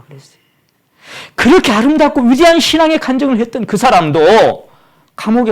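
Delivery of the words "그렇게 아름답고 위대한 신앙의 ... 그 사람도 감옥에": high, loud preacher's cry; fervent preaching. Real, not acted. Preacher recalls the Baptist's great testimony, fervently. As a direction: raise it high and loud, full of fervour.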